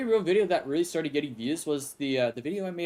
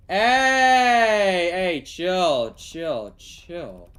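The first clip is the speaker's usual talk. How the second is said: calling out, then easing off; 4 semitones higher, 7 dB louder, much slower than usual. Real, not acted. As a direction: shout to stop them, then trail down. Calling out to stop someone in game.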